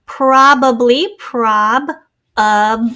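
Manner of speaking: Probably said slowly, then prob, then ub; syllable split. Shown as a demonstration. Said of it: "It's a schwa".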